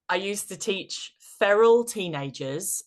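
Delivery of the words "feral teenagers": feral pushed out louder and higher; stress on feral. Real, not acted. Coach describing the teenagers she used to teach, stress on feral. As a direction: lean hard on the word feral. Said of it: "I emphasize the word feral".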